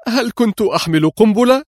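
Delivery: high-pitched, appalled question; shocked cry. A narrator's reading. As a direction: cry out in alarm. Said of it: "شهقت مرة أخرى صائحة". (صيحة ذهول عالية)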